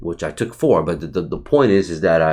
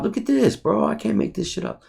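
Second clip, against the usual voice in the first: much higher, amazed at the chart; amazed excitement; 13 semitones higher, 3 dB quieter than usual. Real, not acted. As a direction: pitch leaps way up in disbelief.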